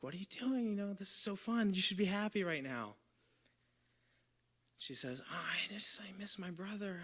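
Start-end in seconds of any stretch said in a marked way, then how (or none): 0.0-2.9 coaxing in a put-on high voice; high voice, easing down toward the end; high-pitched cheering-up. Partygoer urging a sad girl to have fun, high-pitched coaxing. 5.3-7.0 small, high, soft voice; sad girl imitation. Crying girl confiding in a stranger at a party, sad and small.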